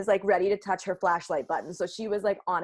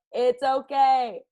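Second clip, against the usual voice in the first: loud, high, drawn-out "okay"; mock reassurance; 5 semitones higher, 6 dB louder, much slower than usual. Friend calls to scared Jaden in the dark, loud reassurance. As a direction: call it out slowly over the panic.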